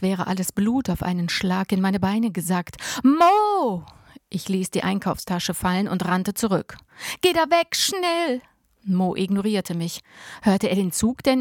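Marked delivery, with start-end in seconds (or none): 3.0-3.8 high, loud scream of a name; frantic call from afar; panicked shout. A narrator's reading. Sees brother on train tracks. 7.2-8.4 high, loud, desperate warning; frantic command; urgent shout. A narrator's reading. Warning brother off the tracks.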